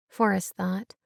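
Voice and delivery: ordinary delivery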